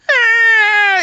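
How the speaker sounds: high, loud, sustained scream; imitated baby scream. Imitating the din of baby pain, high scream. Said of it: "the babies would be screaming".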